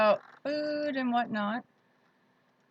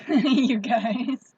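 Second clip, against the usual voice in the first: laughing; 2 semitones higher, 8 dB louder than usual. Real, not acted. Laughs at her own repeat.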